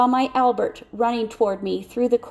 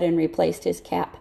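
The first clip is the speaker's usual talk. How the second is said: ordinary delivery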